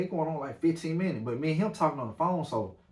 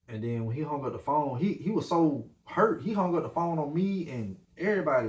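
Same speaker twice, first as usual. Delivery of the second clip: ordinary talk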